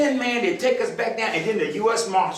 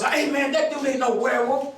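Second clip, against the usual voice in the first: mock yell; 7 semitones higher than usual. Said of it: "somebody yells".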